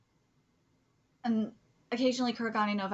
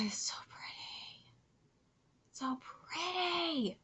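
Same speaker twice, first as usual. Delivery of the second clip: hushed, breathy-free whisper of wonder; soft reverent whisper over pretty art; awed whisper; 5 semitones higher, 7 dB quieter, slower than usual. Real, not acted. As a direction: murmur it in awe, slowly.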